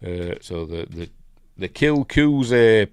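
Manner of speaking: usual speaking voice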